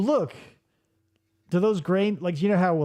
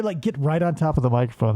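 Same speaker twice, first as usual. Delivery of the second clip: ordinary delivery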